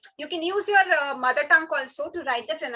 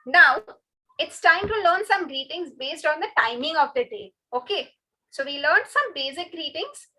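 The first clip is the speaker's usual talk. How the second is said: usual speaking voice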